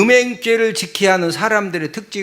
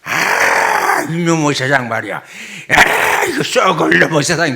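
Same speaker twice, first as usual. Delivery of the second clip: grumbling male customer; 3 semitones lower than usual. Acted. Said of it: "남자들이 무슨 말 하면은요".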